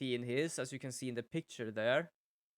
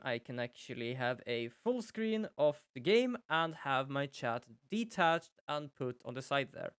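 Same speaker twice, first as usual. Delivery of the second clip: ordinary delivery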